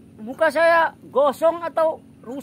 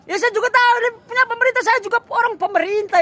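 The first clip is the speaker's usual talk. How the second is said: much higher and fast, indignant; a pitched-up indignant rush on "tahu"; exasperated outburst; 8 semitones higher, faster than usual. Acted. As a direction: blurt it out, fed up with being told. Tenant protesting eviction, exasperated.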